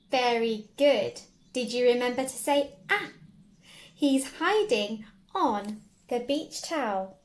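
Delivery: loud thrilled gasp and praise; delighted gasp, very good; excited praise. Acted. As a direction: celebrate the find. Praising child finding Andy.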